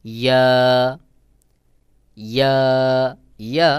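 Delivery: ইয়া with mid-tongue pressed to the palate, held. Shown as a demonstration. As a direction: press lightly and lengthen ইয়া. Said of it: "তালুর সাথে লাগাইয়া একটু চাপ দিয়ে".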